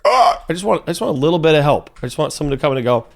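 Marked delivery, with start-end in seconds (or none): 0.0-0.4 frustrated cry. Real, not acted. Frustrated at a broken game.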